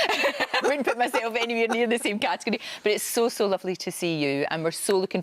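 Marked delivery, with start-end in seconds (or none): 0.1-2.6 talking through a laugh; laughing modestly. Real, not acted. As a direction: laugh off the compliment, pitched up. Laughs off being grouped with stars.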